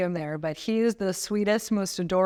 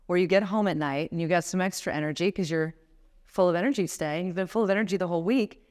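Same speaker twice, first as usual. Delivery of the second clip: usual speaking voice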